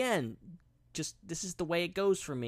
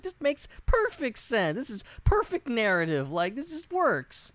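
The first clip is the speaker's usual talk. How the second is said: mock kid awe; 8 semitones higher than usual. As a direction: gush like a believing child. Mocking his childhood belief.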